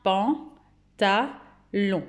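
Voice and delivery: "Pantalon" very slowly in three separate syllables; teacher counting out "Pan-ta-lon" slowly. Shown as a demonstration. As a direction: break "Pantalon" into pan, ta, lon with clear pauses. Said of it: "Trois syllabes".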